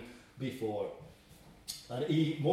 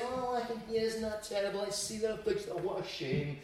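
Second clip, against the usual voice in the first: higher, much softer, limp sighing sympathy; a hollow, hand-wringing "oh dear"; mock pity; 5 semitones higher, 6 dB quieter than usual. Preacher mocking an idle, sighing God with hollow pity. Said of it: "God is not saying".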